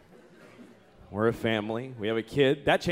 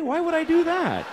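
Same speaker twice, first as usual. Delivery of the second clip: incredulous retort; 13 semitones higher, 4 dB louder than usual. Retold or imitated. Acted retort to wife.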